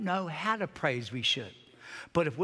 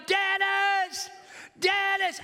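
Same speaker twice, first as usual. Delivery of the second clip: crying out; 12 semitones higher, much slower than usual. Retold or imitated. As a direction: cry the name out urgently. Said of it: "And I'm crying to God".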